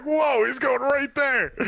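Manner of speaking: high, drawn-out "whoa" of disbelief; mock alarm. Co-host recalls watching him skip the segment, mock alarm. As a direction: exclaim it high and stretched, amused. Acting out his startled reaction.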